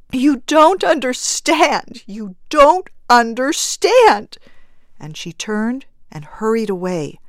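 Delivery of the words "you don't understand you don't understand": high, loud, slow desperate plea; panicked frantic pleading. Acted. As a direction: beg them to understand. Wife repeats in frantic grief.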